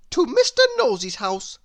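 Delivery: insist, chin up; stubborn little girl. A narrator's reading. Little Miss Stubborn answers Nosey, lightly voiced and firm. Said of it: "she said".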